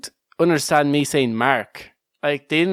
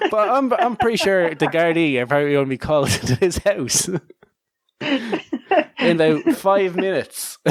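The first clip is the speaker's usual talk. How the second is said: laughing; 3 semitones higher than usual. Real, not acted. Cracking up at the anecdote.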